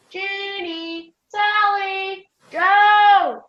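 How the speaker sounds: long, searching holler; calling out. Acted. Calling for missing friends outdoors.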